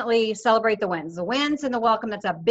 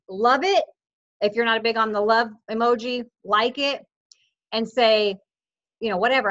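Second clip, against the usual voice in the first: usual speaking voice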